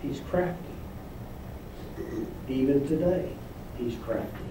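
dropped to a low hush; sly low hush on crafty. Real, not acted. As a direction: lean in and say it softly. Preacher hushes on crafty serpent.

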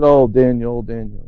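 low, fading, grief-stricken murmur; lamenting sigh. Retold or imitated. King speaking to a man he thinks dead, lamenting. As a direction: let the name die away in grief. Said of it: "has this lamenting voice".

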